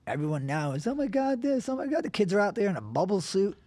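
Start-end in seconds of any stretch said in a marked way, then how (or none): 0.9-2.0 high, mock-panicked gasp; mocking worried modern parents, high fuss; mock fussy parent. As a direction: lampoon a hovering parent, higher.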